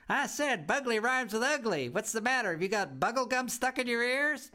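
put-on a cheeky little girl laughing at the house; giggly bratty girl. A narrator's reading. Girl giggling at her new home, high and cheeky. Bea taunts teacher.

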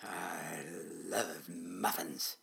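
low, soft, slow dragon mumble; mumbling dragon. A narrator's reading. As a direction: mumble it dreamily low, dragging each word. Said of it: "he mumbled".

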